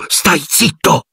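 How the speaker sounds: high, urgent hushed order; desperate hush. A narrator's reading. Harry hushing Ron in the dark forest, desperate high urgent hush. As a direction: silence him before it hears. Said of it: "gli intimò Harry disperato".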